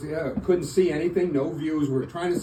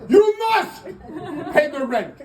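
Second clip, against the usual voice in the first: sneering panto villain; 11 semitones higher than usual. Pretend. Melodrama villain bit.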